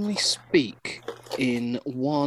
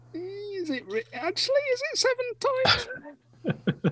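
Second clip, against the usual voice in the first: very high, quick put-on questioning voice; voiced thought. Host retelling his second thought about the joke, high put-on questioning. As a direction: squeak out a mock doubt to yourself. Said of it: "and then I actually thought".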